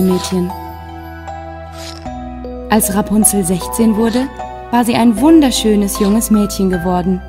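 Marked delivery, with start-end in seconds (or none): none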